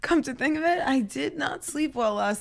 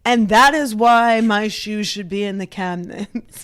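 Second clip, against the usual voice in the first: louder, grand closing argument; mock lawyer; 8 dB louder than usual. Arguing about shoes like a lawyer, mock grandiose. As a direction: deliver it like a lawyer resting the case. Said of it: "I argued my case like I was, like, a lawyer".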